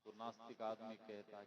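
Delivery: ordinary delivery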